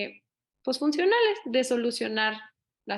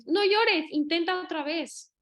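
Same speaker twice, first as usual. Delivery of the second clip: adult telling her not to cry; 6 semitones higher, slower than usual. Retold or imitated. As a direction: push the child to stop crying and retry.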